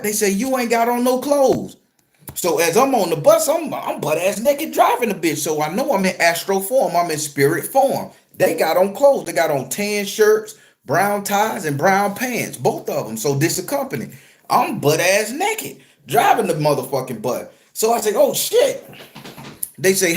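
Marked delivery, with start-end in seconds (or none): 0.3-1.8 higher-pitched imitation of a complaint; mock objection. Firefighters telling the naked driver, mock objection. 18.1-18.9 high-pitched startled realization, retold; mock alarm. Naked driver realizing he is undressed, mock alarm. Acts own sudden realization.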